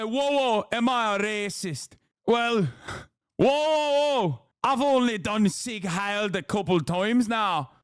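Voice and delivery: imitating a British man in a garbled accent; indecipherable mock British accent. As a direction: do a hammed-up, muddled British accent. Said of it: "doing an indecipherable accent here".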